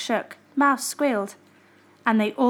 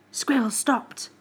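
ordinary delivery, nothing marked

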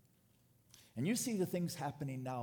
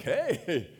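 loud, higher, chuckling unconcern; carefree elderly person; 3 semitones higher, 6 dB louder than usual. Acted. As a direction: shrug it off with a laugh, acting it out.

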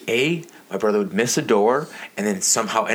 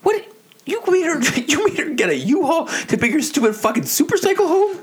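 high, loud, incredulous dad rant; outraged dad; 3 dB louder than usual. Retold or imitated. Imitating dad hearing about the U-Haul, high outraged rant. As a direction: imitate a dad blowing up in disbelief. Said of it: "my dad would be like".

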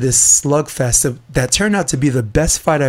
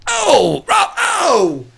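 disgusted shout; 13 semitones higher, much slower than usual. Real, not acted. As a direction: squeal at the gory picture. Reacting to gruesome photo again.

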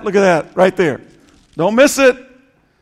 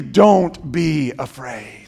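much lower, slow and soft; gentle reassurance; 8 semitones lower, 4 dB quieter, slower than usual. Acted. Pastor voicing Jesus to the fearful, slow gentle reassurance. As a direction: ease it down to a hushed comfort. Jesus comforting, sermon drop.

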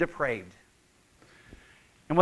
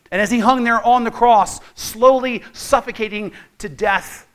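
fervent preaching; 4 dB louder than usual. Real, not acted. Preaching at full force.

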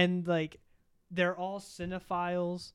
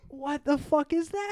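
higher, louder incredulous cry; shocked outburst; 9 semitones higher, 7 dB louder than usual. Real, not acted. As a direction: blurt it out in disbelief. Outraged at the movie.

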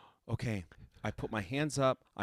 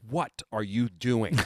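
drawn-out, louder mock exasperation; exasperated teacher; 3 semitones higher, 5 dB louder, much slower than usual. Teacher calling out her student's inconsistent playing, exasperated. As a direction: stretch it out in loud disbelief.

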